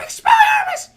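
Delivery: repeated shrieking falsetto spell; Harry Potter. Retold or imitated. Boy disarming the thief over and over, mock shrieked yell. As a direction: yell it loud and squeaky, over and over. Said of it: "He keeps yelling".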